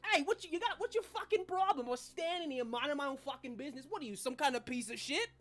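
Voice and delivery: high-pitched, confrontational character voice; belligerent talking trash can. Acted. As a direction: pick a fight with a passer-by. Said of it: "two trash cans with eyeballs".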